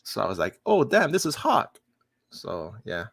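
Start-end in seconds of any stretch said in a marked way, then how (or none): 0.7-1.8 high, surprised fan reaction; excited recognition. Retold or imitated. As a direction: jump up in pitch, delighted discovery. Acted reaction recognising the actor.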